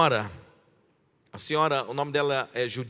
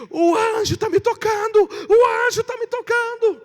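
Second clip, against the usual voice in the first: much higher, louder rapturous cry; ecstatic shouting; 9 dB louder than usual. Retold or imitated. Pastor reenacting the old lady crying out in church, loud high ecstatic shout. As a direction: shout it out like she's being touched by an angel. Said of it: "ela começou a gritar assim".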